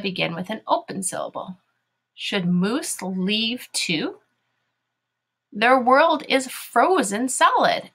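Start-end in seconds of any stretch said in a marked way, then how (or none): 2.1-7.9 very slow read-along, word by word; deliberate classroom reading; reading to children. Shown as a demonstration. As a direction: lead pupils slowly through each word.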